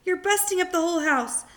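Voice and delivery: put-on a small boy begging grandma to stop; young George, worried. George protests.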